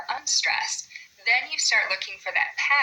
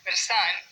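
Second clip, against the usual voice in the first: madi-SON, last syllable stressed; stress on wrong syllable. Shown as a demonstration. Teacher showing how not to say Madison, last syllable stressed. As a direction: Madison with the weight on a full final SON. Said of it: "We don't say medicine".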